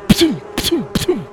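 high, sharp puffs like darts leaving a pipe; imitated blowpipe puffs; blowpipe shots. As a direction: spit out three quick dart sounds.

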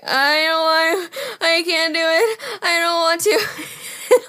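high, slow, drawn-out moan; miserable whining. Retold or imitated. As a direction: whine it out like a sick drunk. Re-enacts moaning miserably on toilet bowl.

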